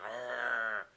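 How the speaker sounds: low monster growl; drawn-out mock beast noise; Yeti. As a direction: growl like a lumbering brute. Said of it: "walks him in the house like".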